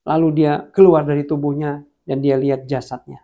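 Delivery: ordinary talk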